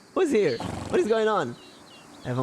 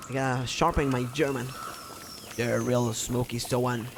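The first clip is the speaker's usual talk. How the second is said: ordinary talk, nothing marked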